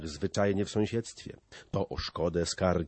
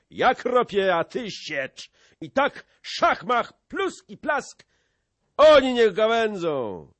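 high, loud, excited brawler; rally your comrade at full voice; boisterous, punchy war-cry delivery; rousing battle pledge; 11 semitones higher, 8 dB louder than usual. A narrator's reading. Brawler eagerly pledges to join fight.

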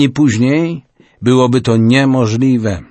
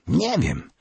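softer, still frightened small answer; a hint of a scared boy, softer; 4 semitones higher, 8 dB quieter than usual. A narrator's reading. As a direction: answer barely above a murmur, still scared. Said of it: "wciąż jeszcze przestraszony chłopiec".

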